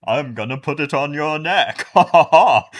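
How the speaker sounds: hammed-up villain threat into loud laugh; mock villain. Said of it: "judging by Felicity in this episode, I think here's what happened".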